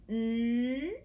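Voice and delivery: "N" as a long held hum; isolated sound. Shown as a demonstration. Teacher reviews first sound, "N" held long. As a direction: sustain the sound of "N", not the letter name. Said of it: "was the first sound".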